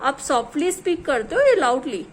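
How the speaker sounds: ordinary delivery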